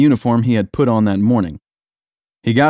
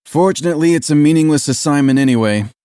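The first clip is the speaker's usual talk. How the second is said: exasperated lieutenant, pitched up; resigned complaint with a raised voice; sighed resignation; 5 semitones higher, 3 dB louder than usual. A narrator's reading. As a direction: sigh it out in exasperation. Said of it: "the lieutenant sighed".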